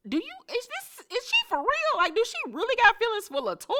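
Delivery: very high, incredulous squeal; shocked falsetto. Retold or imitated. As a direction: squeak it out in disbelief. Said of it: "And I'm like".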